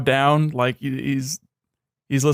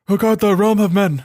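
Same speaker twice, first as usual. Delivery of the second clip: mock Northman bark; 6 semitones higher, 4 dB louder than usual. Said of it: "Northman bark to it".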